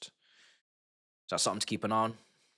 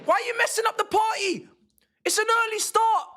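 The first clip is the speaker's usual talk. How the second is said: pleading with the referee; 11 semitones higher, 3 dB louder than usual. Real, not acted. Shouting at referee over call.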